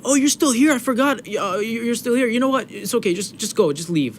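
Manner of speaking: high-pitched mock teacher brushing someone off; flustered teacher. Acting teacher dismissing forgotten child.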